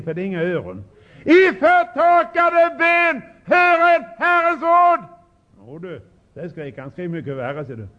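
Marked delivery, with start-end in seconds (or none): 1.2-5.0 much higher, slow, ringing call; calling out loud. Shown as a demonstration. Preacher shows how to preach loudly to dry bones. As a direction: cry it out to deaf bones. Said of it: "Måste tala högt när ni talar till ben".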